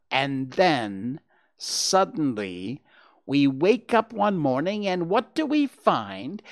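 fussy lecturing animal, affronted; indignant Rabbit. Acted. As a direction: build from deliberate pauses to high, incredulous questions.

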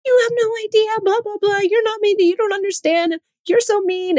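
put-on high whiny child voice ranting; daughter's tirade. Daughter venting at mom, high whiny mock rant. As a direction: play the upset kid going off on mom. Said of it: "she'll go off".

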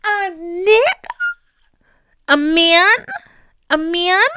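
high, drawn-out disbelief; incredulous scolding. Retold or imitated. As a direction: scold herself in shocked disbelief. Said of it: "put the brakes on".

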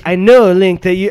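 hammed-up fake British game character; fake British accent. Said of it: "in his fake accent".

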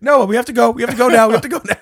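high, loud frantic shouting; mock panic. Man urging friend to flee, imitated panic.

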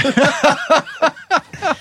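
high real laugh. Real, not acted. Laughing at the joke about the agent. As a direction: crack up at your own joke.

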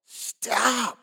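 high, drawn-out mock yell, held back in volume; retold yell. Passenger warning the driver at the corner, mock yell. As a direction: imitate a warning yell without full volume. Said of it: "I yelled at the top of my lungs".

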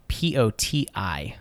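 slow letter by letter spelling. Shown as a demonstration. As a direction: spell each letter slowly. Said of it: "Uh, potty".

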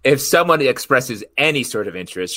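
usual speaking voice

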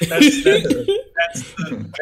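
high laughing; cracking up mid-sentence. Real, not acted. Laughing hard.

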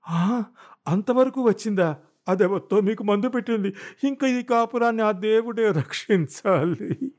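high wailing cry, slowing at the end; wife wails loudly at her husband's retort, pitched high. Acted. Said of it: "బిగ్గరగా రోధించింది".

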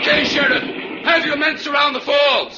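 shouting orders down to the sheriff. Acted. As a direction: carry it over the roar of the falls. Calling down to sheriff below.

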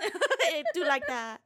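high, slow laughing voice; comic character voice. Pretend. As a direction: laugh through the goofy voice.

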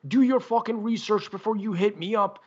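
mock an outraged rant, voice pushed up; offended. Offended fan firing back at the warning, indignant. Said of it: "got really offended by that".

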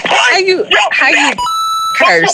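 shocked, high-pitched outcry. Real, not acted. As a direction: yelp it out in disbelief. Shocked outburst.

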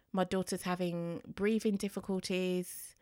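ordinary delivery

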